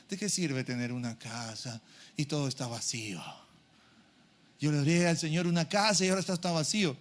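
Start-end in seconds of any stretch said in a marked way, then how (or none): 0.1-3.2 low, soft voice of a lonely soul; quiet, discouraged lament; acted despondency. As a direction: drop right down, as if giving up.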